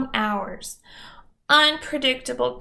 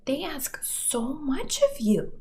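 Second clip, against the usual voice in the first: sympathetic; 5 semitones higher, 5 dB quieter than usual. Retold or imitated. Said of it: "professionals repeatedly told me".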